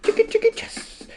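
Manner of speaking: very high cartoon bird squeak; squeaky chicken voice. Silly bird voice jingle.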